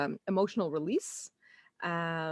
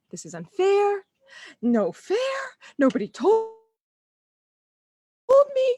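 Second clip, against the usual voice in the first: much higher, louder whiny protest; childish tantrum; 12 semitones higher, 5 dB louder than usual. Retold or imitated. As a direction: sulk like a wronged child. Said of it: "very mature reaction happening".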